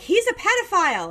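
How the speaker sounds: high, loud hysterical accusation; hammed-up parental alarm; mock panicked mom. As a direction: wail it like an overreacting mother. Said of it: "the mom was like".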